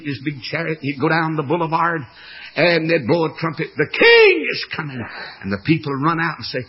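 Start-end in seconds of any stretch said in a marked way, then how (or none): 3.8-5.0 high loud herald announcing the king; herald's call. Retold or imitated. Heralds announcing the king to the crowd, loud call. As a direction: call it out like a trumpet fanfare.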